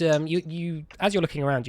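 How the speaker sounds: ordinary talk, nothing marked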